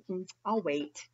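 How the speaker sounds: soft, low, patient little mouse. Acted. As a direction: murmur it calmly. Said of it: "He says".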